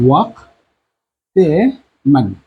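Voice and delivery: ওয়াক্তে মান stretched out while writing; slow dictation. Shown as a demonstration. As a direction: say ওয়াক্তে মান very slowly, drawn out. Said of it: "teacher dictating phrase".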